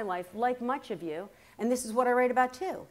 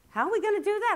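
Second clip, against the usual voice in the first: much higher mock-puzzled question; young daughter's incredulity; 10 semitones higher, 3 dB louder, much faster than usual. Daughter questioning mother about TV repair, high incredulity. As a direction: pitch it right up, imitating a doubtful daughter.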